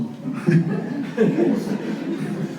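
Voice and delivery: a soft airy chuckle at himself; a hushed chuckle at his own naivety; breathy laugh. Real, not acted. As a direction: laugh quietly under the breath.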